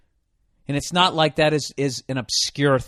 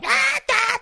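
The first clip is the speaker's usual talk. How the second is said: high mock monkey shrieks; monkey screams. Comic imitating monkey being eaten, high shrieks. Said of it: "as the little monkey is going".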